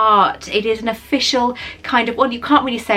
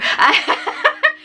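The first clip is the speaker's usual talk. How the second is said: a bright high-pitched giggle; 14 semitones higher than usual. Real, not acted. Vlogger laughing at her own jealousy, high giggle. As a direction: laugh off the envy in a quick giggle.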